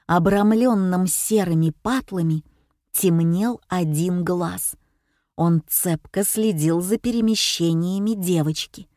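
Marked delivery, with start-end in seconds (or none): none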